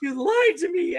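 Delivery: very high mock complaint; whiny outrage. Joe complaining for weeks that they lied, whiny outrage. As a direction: mimic a distraught man's accusation.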